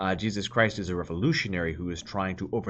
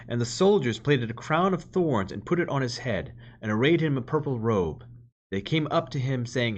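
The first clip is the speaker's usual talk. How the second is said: louder, measured reading of the Gospel; scripture reading; 4 semitones higher, 5 dB louder than usual. Acted. Preacher reads the Passion to the congregation, solemn proclamation.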